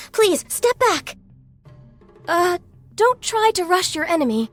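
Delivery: strained, weakened urging; hurt in battle. Acted. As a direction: gasp out a warning while wounded. Hurt in combat.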